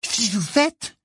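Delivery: put-on high, excited boy's question; enthusiastic. A narrator's reading. Ron hears of new allies, enthusiastic. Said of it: "dit Ron avec enthousiasme".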